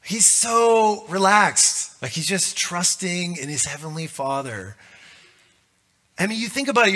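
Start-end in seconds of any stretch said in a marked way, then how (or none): 0.0-2.0 drawn out. Shown as a demonstration. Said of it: "draws out relaxed".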